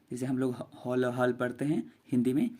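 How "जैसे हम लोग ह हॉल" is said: ordinary talk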